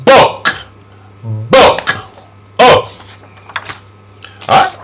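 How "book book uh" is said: high, short uh in Book; uh-sound demonstration. Shown as a demonstration. Teacher models familiar uh, high short Book. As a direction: pitch Book up, isolate Uh. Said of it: "which is the uh".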